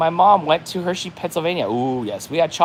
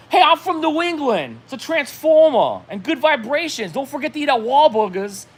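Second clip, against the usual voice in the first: [name] impression; 9 semitones higher than usual. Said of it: "I gotta read it like [name]".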